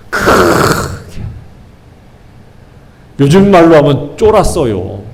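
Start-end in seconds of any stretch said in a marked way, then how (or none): 0.1-1.2 breathless frightened whisper. Shown as a demonstration. Preacher acts out cowering soldiers, whispered in fright. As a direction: hush it out in fear.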